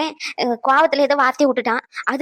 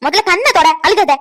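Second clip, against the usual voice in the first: firm loud order; 4 semitones higher, 7 dB louder than usual. Acted. Grandma tells her stop crying.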